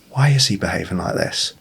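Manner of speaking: soft, genuinely curious question; curious and calm. Shown as a demonstration. Dad calmly wondering about his son, curious. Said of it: "completely different tone".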